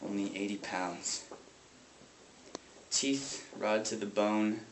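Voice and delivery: low, soft, halting grief; grieving brother. Acted. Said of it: "who weeps over his sister's casket".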